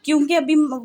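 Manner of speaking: normal talking voice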